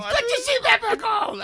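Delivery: frantic pilot. Retold or imitated. Pilot telling him to buckle up, squealing. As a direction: squeal the order like a scared pilot. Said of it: "he said".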